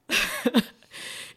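loud breathy burst of laughter; relieved laugh. Real, not acted. Mother recalling her baby is well, laughing with relief.

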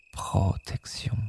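slow whispered echo of Protection; soft spa whisper. Shown as a demonstration. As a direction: breathe Protection. out slowly, whispered. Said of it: "echo of La protection".

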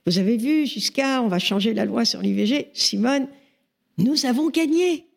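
high-pitched, excited announcement of a victory; breathless young admirer's eagerness, voice leaping upward; bursting with enthusiasm. Retold or imitated. Young activist bursts in on Simone to announce victory, excited. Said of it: "j'arrive pleine d'enthousiasme et je bondis".

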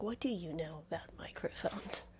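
breathy retold whisper; whispered quote. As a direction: whisper it back in disbelief. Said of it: "And I'm like".

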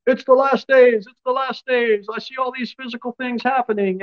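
mock alarm, pitched very high; up in arms. Preacher mimicking people worked up over the last days, mock alarm. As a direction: imitate people sounding the alarm in a high, stretched cry. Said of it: "People all getting up in arms".

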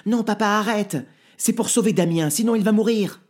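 high, loud desperate plea; pleading girl. Acted. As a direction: beg him to stop. Julie begs her father. (fillette qui supplie, aiguë et forte)

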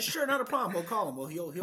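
high, sugary fake-helpful tone; sarcastic. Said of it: "you should have been sarcastic".

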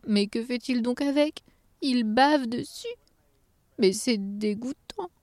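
high, slow elf voice; disgusted little elf. Acted. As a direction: draw it out in dismay.

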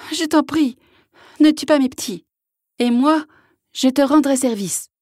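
high, urgent pleading call; calling mother bear. A narrator's reading. Mother bear calls down to hunter, high and pleading. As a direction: beg from afar for your young. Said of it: "la mère ours l'appelait".

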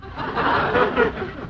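short, bright chuckle; amused laugh. Acted. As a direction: laugh it off lightly. Guest laughs off the insult.

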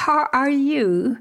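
slow, careful textbook English; English phrase. Shown as a demonstration. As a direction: say it like proper English. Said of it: "En anglais, on dit".

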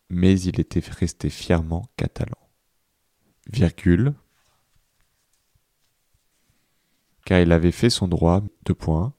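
measured, carefully articulated dictation voice; teacher giving a dictation, punctuation spoken aloud; dictation reading. Real, not acted. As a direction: read it out phrase by phrase for people writing it down, pausing between chunks. Reads out a school dictation.

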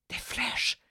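breathy whispered demo. Shown as a demonstration. Coach demonstrates the whisper, fully whispered. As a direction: whisper it, no voice at all. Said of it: "Je vous reprends exactement la même phrase".

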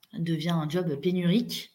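ordinary talk, nothing marked

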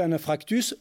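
"infractus" with the r moved forward to follow the f; imitating someone's slip on "infractus"; quoted mispronunciation. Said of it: "Il, il s'est trompé".

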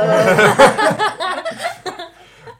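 rire aigu et fort; éclate de rire franchement; grand éclat de rire. Real, not acted. (fou rire aigu et bruyant)

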